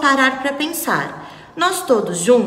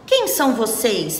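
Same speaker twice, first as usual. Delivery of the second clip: ordinary delivery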